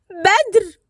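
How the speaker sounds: high terrified scream of his name; frightened scream. A narrator's reading. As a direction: shriek in fear as he falls. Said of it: "وهي بتصرخ بخوف". (صرخة خوف عالية)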